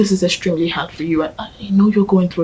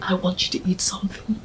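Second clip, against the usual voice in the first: whispered coaxing; 8 dB quieter than usual. Acted. Coaxing friend to eat.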